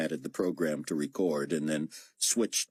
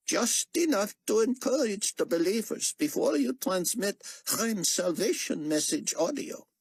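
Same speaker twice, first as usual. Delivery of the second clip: raised, lightly voiced older man; higher voice for the doctor; character voice. A narrator's reading. Xian instructing Chang before the broadcast, lightly voiced.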